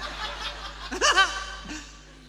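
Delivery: big high burst of laughter; loud laugh. Real, not acted. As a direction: laugh out loud at your own story. Laughs at own story.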